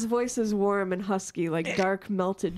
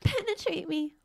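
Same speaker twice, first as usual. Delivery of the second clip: put-on high breathy girlish coo; high cute anime girl; 8 semitones higher, 3 dB quieter than usual.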